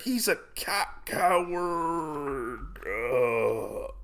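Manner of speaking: high, soft, drawn-out last word; hammed-up death croak trailing into a groan; acted dying gasp. Dying duelist cursing his opponent, acted fading last breath. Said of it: "Kidemono ends up dying".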